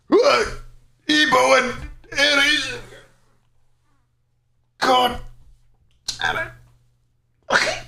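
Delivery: very high, drawn-out exasperated cry; startled squeak. Real, not acted. Streamer reacting on stream, squeaky and exasperated. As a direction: let it out slowly, pitch way up, then trail off.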